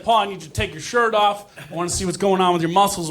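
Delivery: ordinary talk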